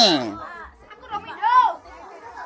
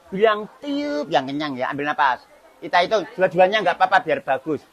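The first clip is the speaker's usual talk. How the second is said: quiet; 4 semitones lower, 8 dB quieter, faster than usual. Real, not acted. Coaching toddler softly.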